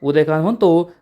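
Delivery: plain reading voice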